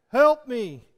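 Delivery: put-on loud desperate cry of a lost boy; hollering for help. Boy hanging on a bank yells for help. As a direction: shout it up from the bank.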